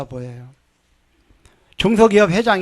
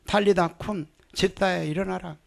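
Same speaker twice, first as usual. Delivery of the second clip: quiet, steady command; quiet proclamation; 9 dB quieter than usual. Shown as a demonstration. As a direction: proclaim it calmly under the breath. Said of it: "저는 그렇게 선포합니다".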